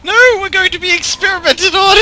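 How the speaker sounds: mock panic.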